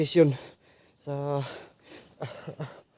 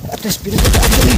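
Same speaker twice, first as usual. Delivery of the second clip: urgent shout; 5 semitones higher, 8 dB louder than usual. Real, not acted. Reporting being shot at.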